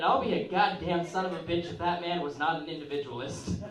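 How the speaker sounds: ordinary delivery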